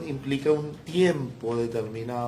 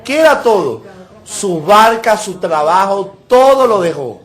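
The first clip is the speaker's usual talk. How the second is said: loud, high, fervent preaching; rousing pulpit cry, voice pushed to the top; preaching at full force; 3 semitones higher, 8 dB louder than usual. Acted. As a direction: lift the congregation, thundering the point home. Preaching climax on Peter.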